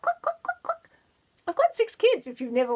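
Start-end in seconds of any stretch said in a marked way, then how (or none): 0.0-0.9 mock clicking sound.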